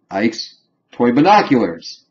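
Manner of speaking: ordinary delivery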